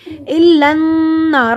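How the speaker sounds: الا النار slow, with a long hummed n before نار; nasal ghunnah. Shown as a demonstration. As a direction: hold the doubled n of النار in a nasal hum. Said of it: "نون پہ غنہ ہوگا".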